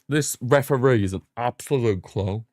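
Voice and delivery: ordinary talk, nothing marked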